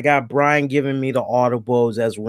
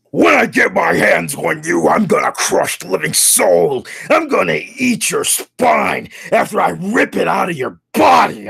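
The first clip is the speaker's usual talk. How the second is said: loud, higher roided-up bellow; roided freak voice; 5 semitones higher, 4 dB louder than usual. Retold or imitated. Wrestler trash-talking his opponent, roided bellow. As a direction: bark threats like a juiced-up wrestler. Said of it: "talking rather like a roided freak".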